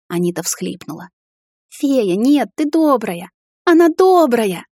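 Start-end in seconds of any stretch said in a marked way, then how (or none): 1.7-4.7 put-on high pleading child shout; child crying out. A narrator's reading. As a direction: beg the crowd desperately. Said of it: "кричала девчушка". (отчаянный детский крик)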